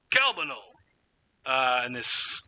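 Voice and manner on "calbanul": high call sliding down at the end; falling call. Acted. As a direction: call CALBANUL high, then let it fall. Gnome calls for someone again.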